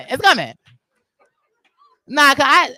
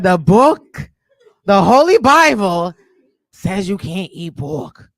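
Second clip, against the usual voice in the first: mock preachy friend; 3 semitones lower, 5 dB louder, much slower than usual.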